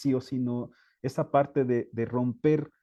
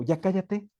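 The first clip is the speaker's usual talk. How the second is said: mock angry teacher; 7 semitones higher than usual. Teacher silencing a crying pupil, sharp mock command. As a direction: snap at the child to shut up. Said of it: "una parte negativa del docente".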